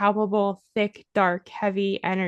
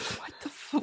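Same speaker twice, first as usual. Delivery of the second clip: voiceless whisper, much softer than usual; whispered disbelief; 12 dB quieter than usual. Retold or imitated. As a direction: whisper it as a stunned aside, cut off. Stunned reaction to the woman's claims.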